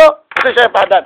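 ordinary talk

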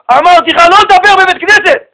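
angry shout. Retold or imitated. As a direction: yell at the boy like the furious father. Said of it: "צעק עליו". (צעקה זועמת של האב על הבן)

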